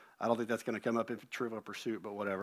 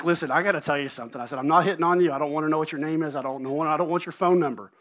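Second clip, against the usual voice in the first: retold insistence, louder and pressing; urgent, louder self-defense; emphatic disclaimer; 3 semitones higher, 7 dB louder, faster than usual. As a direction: rattle off firm denials to a stranger. Acted emphatic disclaimer to stranger.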